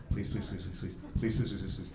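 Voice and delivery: low, very soft repeated begging; hushed mock pleading. Team willing the delicate cut to work, hushed mock pleading. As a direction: chant it under your breath like a nervous prayer.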